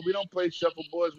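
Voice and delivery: normal talking voice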